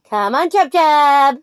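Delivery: high, slow sing-song coaxing; doting pet talk. Real, not acted. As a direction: coax the rooster gently to crow. Coaxing rooster to crow.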